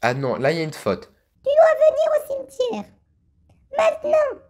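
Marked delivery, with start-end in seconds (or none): 1.4-4.4 put-on much higher, thin old woman's voice; little old lady voice. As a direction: squeak it out like a tiny grandma. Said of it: "on va faire une petite voix de vieille".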